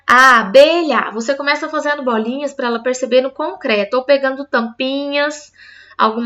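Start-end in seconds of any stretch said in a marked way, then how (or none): 0.0-1.1 "A abelha" drawn out slowly, louder, one syllable at a time. Shown as a demonstration. Teacher showing a child the three pieces of abelha, slow syllable by syllable. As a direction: stretch "abelha" into a-be-lha. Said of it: "três bolinhas de massinha pra cada pedacinho".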